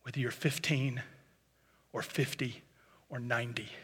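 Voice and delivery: very soft, low, pleading voice; gentle. Real, not acted. Preacher pleads gently with his congregation to let go: very soft pleading. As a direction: plead gently, almost in a hush. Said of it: "I want to sound as gentle as I can right now".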